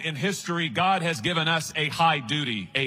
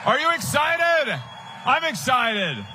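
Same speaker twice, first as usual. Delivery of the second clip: rallying the crowd; 7 semitones higher than usual. Real, not acted. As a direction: pump up the room, calling out.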